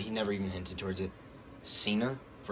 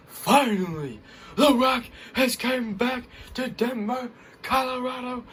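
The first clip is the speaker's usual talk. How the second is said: higher, louder, slow drawn-out announcement; imitating The Rock; 7 dB louder, slower than usual. Vlogger quoting The Rock greeting Denver, drawn-out announcement. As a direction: boom it out like the Rock on the mic.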